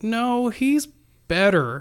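high-pitched "no", then a pause before the reveal; retold bewildered news. Nurse telling the doctor of the recovery, mildly bewildered.

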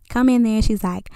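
ordinary talk

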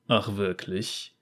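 low, slow, scornful man's voice; snorting bounty hunter. A narrator's reading. Bounty hunter scoffs at the little girl, low and slow. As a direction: scoff it low and slowly, unimpressed. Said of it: "Der Kopfgeldjäger schnaubte".

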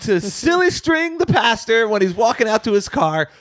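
put-on hyped youth group kid.

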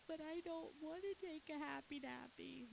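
put-on high, soft toddler whine; put-on childlike pleading; protesting child. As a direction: imitate a small boy objecting. Child protesting nap, retold.

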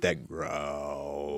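very low, slow bass rumble; low growl. Shown as a demonstration. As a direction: drop an octave into a growl. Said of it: "like, super low".

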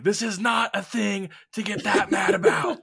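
very high-pitched mock yell; yelling back. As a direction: re-enact yelling back at an angry coworker. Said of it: "I'm just yelling right back at him".

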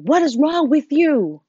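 put-on mother yelling at a fallen child; retold angry yell. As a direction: snap it out like a stressed mother yelling. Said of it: "she just started yelling at me".

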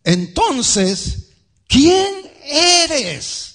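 loud, very high, insistent shout; exasperated demand. Acted. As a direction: demand an answer at full voice. Acted questioners losing patience.

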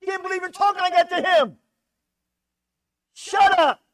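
loud, high shout of shut up; sharp rebuke. Acted. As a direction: silence him hard, outraged. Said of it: "rebukes the first guy".

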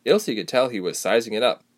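plain reading voice

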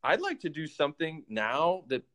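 ordinary talk, nothing marked

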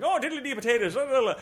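put-on high, sing-song stage-Irish accent; mock Irish brogue. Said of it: "going into a brogue".

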